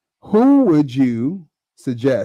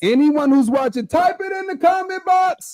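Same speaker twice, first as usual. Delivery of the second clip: shouting to viewers. Real, not acted. Calling out to all viewers.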